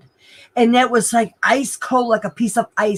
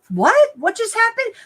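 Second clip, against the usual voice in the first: bewildered; 12 semitones higher than usual. Retold or imitated. Retold confusion at crosswalk.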